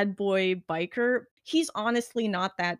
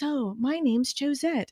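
prim lady voice; 3 semitones higher, slower than usual. Retold or imitated. [name] pretends to be Josette.

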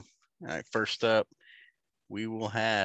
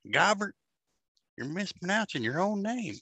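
mocking yokel; 11 semitones higher than usual. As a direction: lecture him like a smug local. Mock yokel scolding Gobert.

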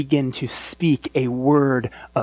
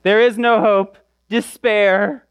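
high, raised mock doom; mock herald of despair, pitched up; voice of a despairing world; 7 semitones higher, 4 dB louder, slower than usual. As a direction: proclaim doom as the hopeless world. Voicing the doomsaying world.

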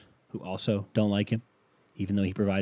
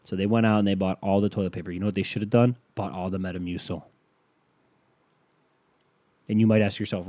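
ordinary talk

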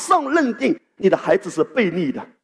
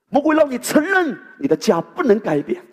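认 hit hard, whole line pitched higher; rising stress on 认; 7 semitones higher than usual. Shown as a demonstration. The pastor builds his list of the devil's lies, pushing harder on 认 in 承认. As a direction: build the repetition up, punching 认 in 承认.

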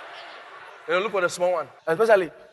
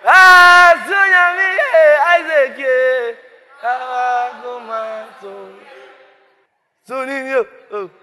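high, loud wailing in Yoruba; wailing cry; 9 semitones higher, 11 dB louder, slower than usual. Acted. Abraham lamenting loudly over his son Isaac.

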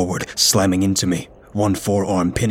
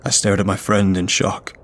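ordinary delivery, nothing marked

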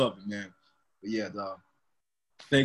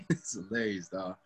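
soft laughing repeat; 8 semitones lower, 11 dB quieter than usual. Real, not acted. Repeating the joke to his friend, laughing. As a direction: say it again through a quiet laugh.

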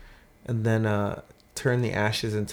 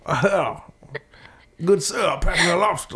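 mock Southern; 6 semitones higher than usual. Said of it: "my parents become Southern people".

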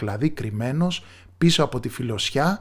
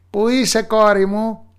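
high, loud witch call; witch searching; 9 semitones higher, 6 dB louder than usual. Acted. Witch searches the empty room calling her daughter.

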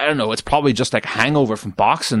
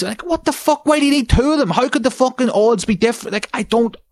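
outraged rant; 12 semitones higher than usual. Real, not acted. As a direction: blow up at the absurdity.

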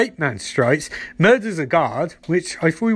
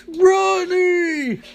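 a much higher, drawn-out mock cry of his name; anguished cry; 12 semitones higher than usual. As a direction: wail it after him as the door shuts. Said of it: "And Caitlin's like".

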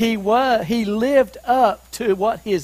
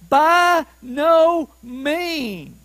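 each word of "By no means" drawn out high, very slowly; emphatic refusal; 4 semitones higher, 4 dB louder, much slower than usual. Acted. Preacher giving Paul's answer on injustice, hugely emphatic. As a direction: pound out BY, NO, MEANS one at a time. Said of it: "It's the most emphatic way of saying no".

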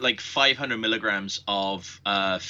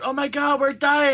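high-pitched hammed-up panic; mock freak-out; 3 dB louder than usual. Mimicking everybody else freaking out, mock panic. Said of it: "everybody else is freaking out".

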